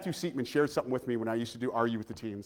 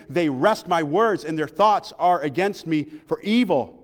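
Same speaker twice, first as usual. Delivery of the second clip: emphatic psalm reading with drawn-out phrases; declaimed scripture reading; 4 semitones higher, 9 dB louder than usual. Real, not acted. Preacher reading David's psalm to church, declaimed scripture. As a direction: proclaim the verse to the congregation.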